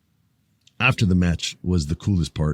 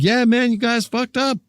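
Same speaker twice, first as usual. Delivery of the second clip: mock taunt; 14 semitones higher than usual. Siding with Roman, voicing himself.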